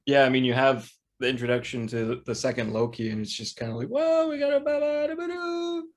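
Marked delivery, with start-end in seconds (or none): 3.9-5.9 very high silly nonsense babble; mock babble. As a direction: squeal it out in a high put-on voice. Mimics the show's wild turn.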